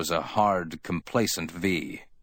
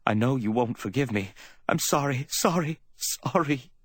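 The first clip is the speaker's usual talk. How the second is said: high, whimpering plea; whimpered apology; 7 semitones higher than usual. A narrator's reading. Woman begging Sam's forgiveness, whimpering. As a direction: repeat the apology, climbing higher. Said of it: "she whimpered".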